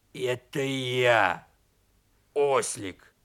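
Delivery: slow, heavy wolfish donkey voice; strange changed voice. A narrator's reading. Donkey answering bear cub at the door, strange voice. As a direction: draw it out in a creature's voice. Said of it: "удивился своему голосу".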